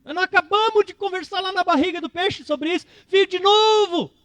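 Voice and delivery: high, pleading father scolding a stubborn son; exasperated father. Retold or imitated. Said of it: "ia olhar pro Jonas e dizer assim".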